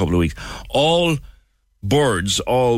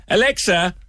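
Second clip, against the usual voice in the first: mock command; 9 semitones higher than usual. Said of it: "Will I do it again?".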